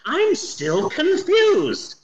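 high, drawn-out bafflement; acted bewilderment. Halfway into the film, recalling own bafflement, bewildered.

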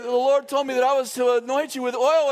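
flustered lady voice. Retold or imitated. Lady explaining the oil, lightly voiced flustered woman. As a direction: rush it like a flustered churchgoer. Lady's excited reply retold.

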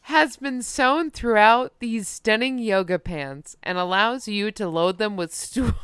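ordinary talk

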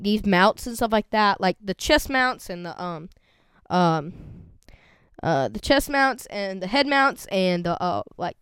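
normal talking voice

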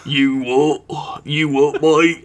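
put-on troll-like English accent. Echoing co-host's yell as a Hobbit troll, bad English accent. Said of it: "English accent is trolls from The Hobbit".

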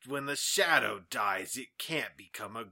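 hushed, grave trailer narration; ominous movie-trailer voice, lightly played; mock trailer narrator. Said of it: "the trailer narration was just very".

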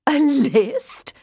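high, loud hooting through laughter. A narrator's reading. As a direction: hoot the words out between laughs. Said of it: "practically hooting between words".

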